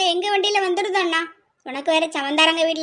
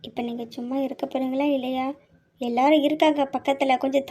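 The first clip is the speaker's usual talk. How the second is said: soft, lower, pressed rebuke; hushed scolding; 4 semitones lower, 6 dB quieter than usual. Acted. Wife hushing her husband with relatives nearby, hushed scolding. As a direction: tell him off under your breath.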